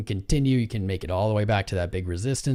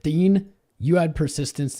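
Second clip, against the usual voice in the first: calling out; 7 semitones higher, 6 dB louder than usual. Real, not acted. Calling out to a chatter.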